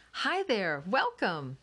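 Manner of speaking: high, hearty near-shout; nearly shouting. A narrator's reading. Mom welcomes the downstairs neighbors, practically shouting. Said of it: "Missus Smithfork practically shouted".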